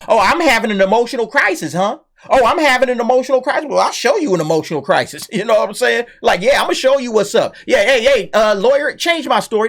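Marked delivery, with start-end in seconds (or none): 0.1-5.3 high-pitched, put-on offended comeback; hammed-up affronted retort, starting high; mock indignation. Voices the woman's indignant comeback. 6.4-9.7 higher-pitched imitation of an offended accuser; playfully put-on payback voice; mock vengeful retort.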